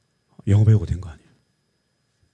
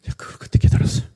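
whisper; faster than usual. Real, not acted. Realization whispered.

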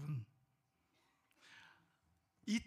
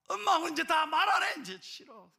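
loud, high burst, dropping off at the end; angry daughter; 9 semitones higher, 9 dB louder than usual. Acted. Daughter refusing to talk to mother, angry. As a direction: throw a child's angry tantrum at mum. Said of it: "막 그러고 화를 내는".

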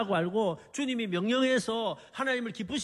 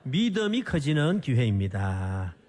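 slow, low, measured call to repeat; lead for repetition; 6 semitones lower than usual. Shown as a demonstration. Preacher leads congregation to repeat after him, slow and measured. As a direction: spell it out slowly for everyone to echo. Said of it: "한번 따라서 해보세요".

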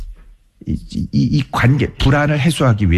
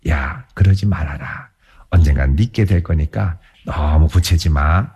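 lower, slow coaxing father; 9 semitones lower than usual. Retold or imitated. Father coaxing his son not to demand the inheritance, low and slow. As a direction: slowly plead with the son, voiced lower. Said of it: "회유하겠죠".

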